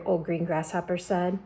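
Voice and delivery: ordinary delivery, nothing marked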